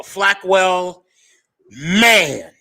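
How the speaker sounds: usual speaking voice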